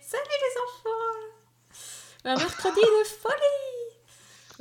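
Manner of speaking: put-on very high, slow children's TV host; [name]. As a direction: sing it out like a kids' show host. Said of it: "c'est comme [name]".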